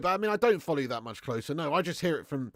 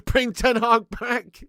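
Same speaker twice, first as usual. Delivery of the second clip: mock plea; 8 semitones higher, 9 dB louder, slower than usual. Mock demand reading comment.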